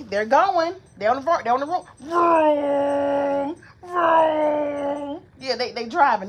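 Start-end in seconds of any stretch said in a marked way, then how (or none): 0.0-1.9 mild narrator voicing. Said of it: "Then he say". 2.0-5.2 drawn-out rising car vroom; vroom car noise. Acted. Mom to baby, imitating a car engine.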